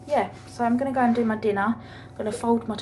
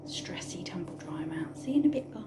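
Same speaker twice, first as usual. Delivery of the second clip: soft whispered goodbye; a hushed whispered sign-off; 6 dB quieter than usual. Real, not acted. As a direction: breathe the words, almost silent. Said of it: "whispered sign-off".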